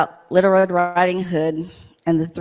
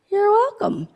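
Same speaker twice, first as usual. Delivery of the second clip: much higher, bright and chirpy reply; cheery bear voice; 14 semitones higher than usual. Acted. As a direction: pop up high like a cartoon helper. Bear answers Little Red.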